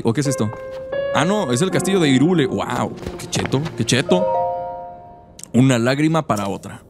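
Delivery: usual speaking voice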